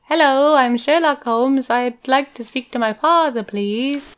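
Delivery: high, slow, overly polite son on the phone; wheedling son. Pretend. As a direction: lay on sweetness to ask a favor. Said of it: "let's reenact the phone call".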